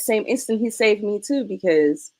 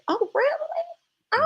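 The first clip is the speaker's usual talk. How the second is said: very high, drawn-out ominous surprise; sarcastic calm before the storm; drawn out and sky-high, fake innocent; mock surprise; much slower than usual. Mother hearing son was teased.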